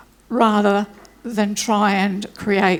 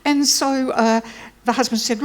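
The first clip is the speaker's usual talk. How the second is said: normal talking voice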